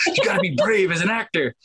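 high-pitched mock pep talk; arguing with oneself. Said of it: "arguing with myself".

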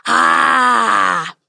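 loud open-mouthed roar; dragon roar. A narrator's reading. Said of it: "opened wide and roared".

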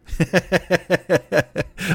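long run of high chuckles. Real, not acted. Laughing at his own program joke, high chuckle. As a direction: chuckle rapidly, high in pitch.

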